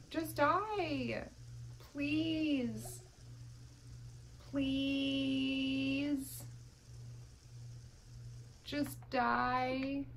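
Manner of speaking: high, drawn-out begging; playfully whiny plea; mock pleading. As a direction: stretch each plea out, worn down and desperate. Begging the Sim to die.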